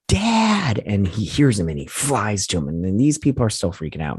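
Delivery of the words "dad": high retold cry for dad; calling out. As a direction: call to your father in a high voice. Retold son calling out to father.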